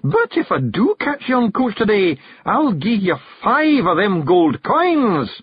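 high Scots promise, slowing at the end; bearded passenger, bargaining. A narrator's reading. Passenger promising gold to the carter, emphatic high Scots. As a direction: draw out the offer in high Scottish voice.

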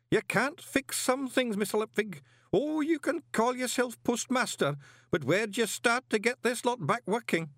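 put-on a fond old man taking leave; old worshipful master. A narrator's reading. Old master's long speech.

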